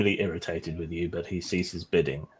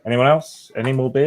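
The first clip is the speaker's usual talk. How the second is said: raised, higher auctioneer call; rally the room for bids; 6 semitones higher, 6 dB louder than usual. Acted.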